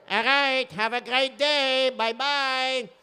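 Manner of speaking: high sing-out farewell; cheery send-off. Acted. Bus driver sending off the kid, high drawn-out farewell. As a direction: call a drawn-out goodbye to a child.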